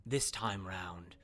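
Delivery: slow, low, deliberate delivery; measured crime-boss advice. Acted. Crime boss advising a released captive, slow and deliberate. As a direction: draw out the advice, letting it sink down. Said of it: "she looks to you and goes".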